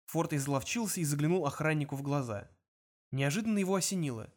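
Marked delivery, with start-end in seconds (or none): none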